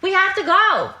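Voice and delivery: loud, high-pitched holler; impatient shout. Real, not acted. Mentor hurrying housemates who are late, impatient shout. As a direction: shout to hurry everyone out the door.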